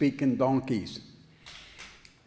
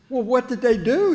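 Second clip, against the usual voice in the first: pitched up, louder, pressing for an answer; alarmed question; 9 semitones higher, 8 dB louder than usual. Retold or imitated. Newcomer in heaven asks the angel, loud and startled. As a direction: demand it, shocked.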